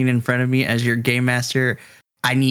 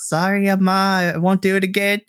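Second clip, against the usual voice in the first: slightly higher sheepish apology; sheepish son; 7 semitones higher than usual. Acted. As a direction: apologize like a caught kid. Diego apologizing to mom.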